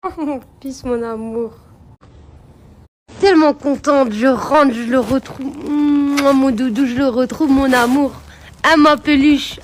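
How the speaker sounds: high, gushing pet-talk to a beloved dog. Real, not acted. Owner reunited with her dog, gushing baby talk.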